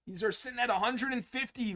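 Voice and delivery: normal talking voice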